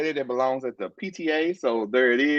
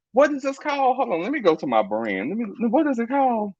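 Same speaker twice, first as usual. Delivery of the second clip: normal talking voice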